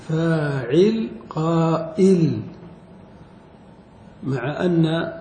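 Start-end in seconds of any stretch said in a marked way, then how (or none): 0.1-2.4 فاعل and قائل each stretched slowly; slow pattern match. Shown as a demonstration. Teacher slowly matching the word to its pattern. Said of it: "فجعلوا الهمزة مقابل العين".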